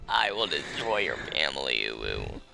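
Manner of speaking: very deep demonic growl; deep evil demon threat. Acted.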